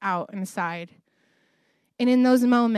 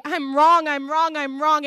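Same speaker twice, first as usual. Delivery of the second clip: retold humbled plea; 4 semitones higher, 5 dB louder than usual. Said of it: "to humble myself and say".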